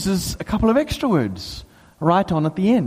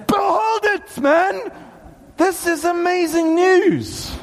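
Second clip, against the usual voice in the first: high, impassioned preacher's cry; excited shouting; 10 semitones higher, slower than usual. Real, not acted. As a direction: shout astonishing news, stretching the words. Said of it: "I'm shouting in a space with a few people".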